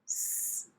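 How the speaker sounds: a bare voiceless "S-", breath only; unvoiced hiss. Shown as a demonstration. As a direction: push out "S-" as pure air with no voice. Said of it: "like that".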